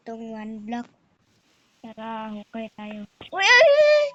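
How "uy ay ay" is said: screaming while falling. Real, not acted. Nahulog ako.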